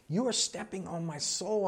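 toned-down imitation of an outraged woman; mock outrage. Requotes the outraged woman, softer.

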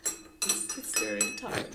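ASMR voice. Pretend. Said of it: "an A S M R of tea drinking".

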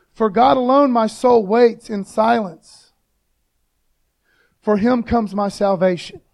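loud, slow proclamation of a psalm; ringing, emphatic Bible reading; preacher reading scripture. Acted. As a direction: declare the verse out to the congregation. Reads the psalm aloud to congregation.

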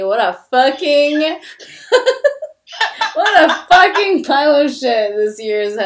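loud laughing exasperation; laughing rant. Real, not acted. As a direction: vent loudly while cracking up. Laughing rant about bad year.

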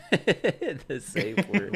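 laughing through "the safe word", drawn out; real laughter. Real, not acted. Asked about safe words, he breaks up laughing. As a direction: giggle out "the safe word" slowly. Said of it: "Why are you laughing".

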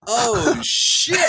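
high, breaking into laughter; shocked amusement. Real, not acted. Podcaster floored by co-host's brazen answer, high and laughing. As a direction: squeal it out, cracking up.

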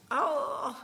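a pained cry of grief; gut-wrenching groan. Retold or imitated. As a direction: let out a grieving groan. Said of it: "a gut-wrenching".

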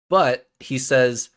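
usual speaking voice

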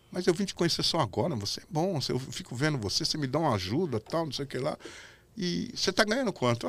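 hushed-down, confidential retelling of his words; Silvio in private. Retold or imitated. Silvio praises the helper in the dressing room, soft imitated voice. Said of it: "me chamou no camarim e falou".

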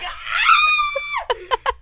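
very high, long shriek; piercing acted-out shriek; reenacted scream. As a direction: scream in mock horror. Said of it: "And I scream again".

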